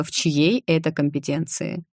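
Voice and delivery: usual speaking voice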